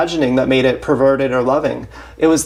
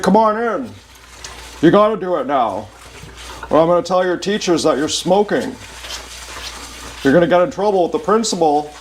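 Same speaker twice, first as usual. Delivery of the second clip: coaxing abuser; 3 semitones higher than usual. Retold or imitated. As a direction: lure them in, then threaten to tell the teachers. Inner voice voicing abuser threat.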